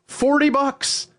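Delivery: indignant disbelief. Real, not acted. Owner shocked at the pet store price, indignant cry. Outraged at elk horn price.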